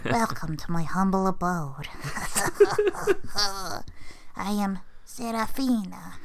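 slow, sinister villain greeting. Pretend. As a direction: taunt the hero like a scheming witch.

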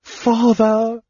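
high, drawn-out cry; high-pitched desperate call; anguished cry. A narrator's reading. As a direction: call out to your father in alarm. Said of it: "Tanis heard Alhana cry".

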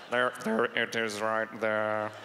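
low, slow drawl; low, drawn-out professor voice; Snape. Acted. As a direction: settle slowly into a low villainous British voice. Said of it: "I gotta find Snape's voice".

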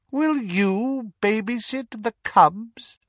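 high, cold, mocking villain voice; cold dark lord. Dark lord mocking the Death Eaters, cold and sneering.

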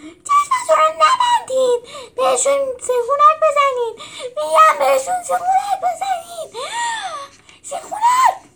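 high, loud yelling at the trainees; shouted orders. Acted. Coach yelling at gladiator pupils, loud and high. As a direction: bellow commands over the din, half laughing.

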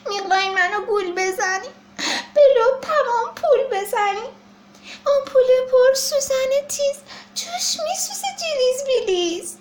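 high, loud frightened whine; panicky child. Acted. Baby elephant protesting in fear of the doctor's needle.

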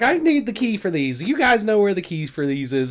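lightly voiced flustered cashier; mock checkout lady. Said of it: "and is like".

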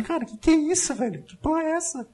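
incredulous viewer shouting at the screen; mock disbelief. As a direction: react like you can't believe the plot twist. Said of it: "Eu falei".

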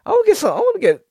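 high squeaky mock friend voice. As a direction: mimic a whiny friend asking for boba. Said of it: "one of them was like".

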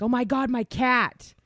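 remembering the cat, panicking; sudden panic. Retold or imitated. On oxygen, remembering her cat upstairs, sudden panic. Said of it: "I'm like".

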